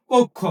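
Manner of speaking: কক্ষ said "kokkho", rounded o; o sound in কক্ষ. Shown as a demonstration. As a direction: punch out কক্ষ as "kok-kho". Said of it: "ক এর উচ্চারণটা ওকারের মতো".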